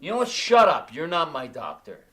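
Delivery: very loud shut up; furious drunken yell; angry shout. Acted. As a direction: shout the friend down. Drunk man silences friend.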